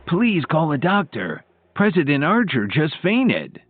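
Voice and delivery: alarmed plea without shouting; calling for help. A narrator's reading. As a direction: call out for a doctor in mild alarm. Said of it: "heard someone call out for help".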